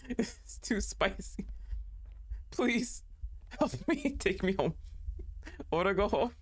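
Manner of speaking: normal talking voice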